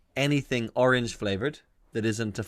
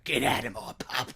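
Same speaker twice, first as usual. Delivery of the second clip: whispered rasp after shouting; hammed-up voiceless pub landlady order; pub boss; 3 dB quieter than usual. [name] throwing out street rat, forced whispery boss voice. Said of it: "telling a little street rat".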